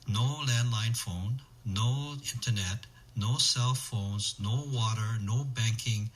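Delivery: ordinary talk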